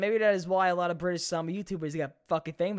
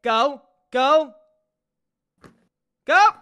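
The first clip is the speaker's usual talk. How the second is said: louder rising calls of go; will the ball onto the green; mock golf fan cheering the ball; urging the shot; 7 semitones higher, 11 dB louder, much slower than usual.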